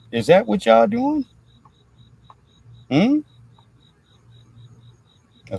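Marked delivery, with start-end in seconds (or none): 0.1-3.2 much higher, louder needling question; mock incredulous. Real, not acted. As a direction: call them out in disbelief. Mock questioning news about fraud.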